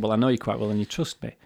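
ordinary talk

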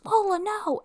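a mother shrieks to stop the dog; mom yelling. Retold or imitated. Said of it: "she goes".

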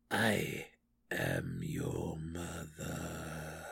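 very slow, low, soft; sinister slow reveal. Acted. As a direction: draw each word out, menacing. Malice speaks through the corpse.

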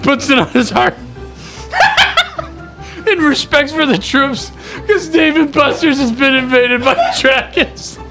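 high, wheezing laugh-crying words; crying with laughter, barely getting it out. Real, not acted. Telling joke, helpless laughter.

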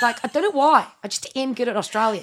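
Australian accent. Shown as a demonstration. Host bragging to co-host about accents, in broad Australian accent. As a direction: boast in a playful Australian accent. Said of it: "You sound like Margot Robbie there".

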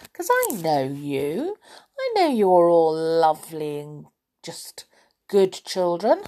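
usual speaking voice

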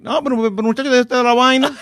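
high, singsong Dominican protest; Dominican accent. Shown as a demonstration. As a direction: pile it on in melodic Dominican Spanish. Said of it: "And the Dominican be like".

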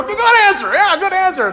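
a hint of a forced game-show cheer, high; Family Feud cheer. Preacher imitating game-show contestants, mock cheer. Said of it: "they all have to contractually go".

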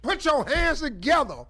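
grand entrance announcement. Acted. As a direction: announce her like a star arriving. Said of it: "do that introduction at every venue".

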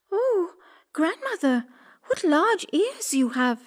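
put-on high girl's voice, amazed; storybook girl's high wonder; wondering little girl. A narrator's reading. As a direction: marvel in a high girlish voice. Girl marvels at disguised wolf.

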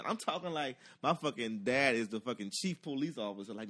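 ordinary talk

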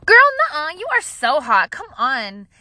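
high, loud hype-up, easing down; loud, high-pitched BFF gushing; gushing girlfriend. Retold or imitated. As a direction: hype up a friend over drinks. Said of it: "that girlfriend friend you have that's everybody's BFF".

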